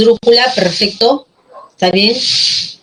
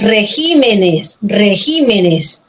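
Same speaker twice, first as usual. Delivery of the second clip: REGÍMENES with the accent moved onto GÍ; stress on GÍ; 2 semitones lower, much slower than usual. Shown as a demonstration. Teacher models the plural for students, stress on GÍ. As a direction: stretch and stress the second syllable of REGÍMENES. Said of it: "sino la sílaba ji".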